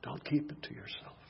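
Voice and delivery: soft unvoiced whisper; a breathy closing plea. Preacher urging listeners, whispered appeal.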